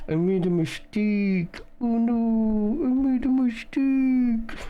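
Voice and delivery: high, slow and loud, teeth clenched; through clenched teeth. Retold or imitated. Imitating Zeke's silly voice, teeth together and high-pitched. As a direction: squeeze the words out through gritted teeth like a cartoon. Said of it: "put his teeth together and be like".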